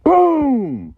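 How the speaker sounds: loud, high, drawn-out boom; big booming impact, high and loud; imitated crash. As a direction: shout out a crash sound effect.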